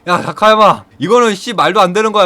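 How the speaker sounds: loud, high alarmed protest; urgent protest. Retold or imitated. Coach pleading with Takayama to stop the fight, loud alarmed protest.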